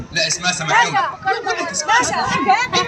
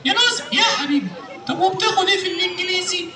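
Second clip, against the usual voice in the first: ordinary delivery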